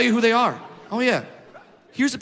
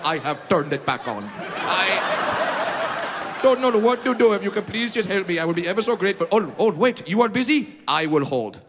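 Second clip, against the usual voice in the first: mock Indian accent. Friend calling for computer help in a mock Indian accent. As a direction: ham up a desperate Indian friend begging for help. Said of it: "your Indian friend just called you like".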